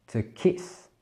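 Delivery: to kiss, said the English way; English pronunciation. Shown as a demonstration. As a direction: say to kiss with a short i. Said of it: "Embrasser qui en anglais se dira". (prononcé à l'anglaise)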